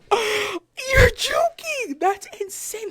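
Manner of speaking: high, loud, drawn-out squeal; amazed disbelief. Retold or imitated. Cashier hearing about purple sweet potatoes, amazed disbelief. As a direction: stretch it out slowly in thrilled disbelief.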